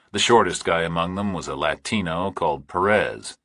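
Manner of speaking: plain reading voice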